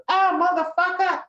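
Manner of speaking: high, loud mock shriek of pain; imitated scream. Storyteller imitates maced woman screaming, loud high shriek. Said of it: "and she goes".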